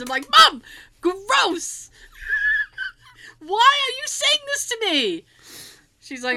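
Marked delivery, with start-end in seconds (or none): 0.3-5.2 high, loud squealed complaint; hammed-up embarrassed outburst; mortified protest. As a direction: whine at mom in disgust. Retold embarrassment at mom's remark.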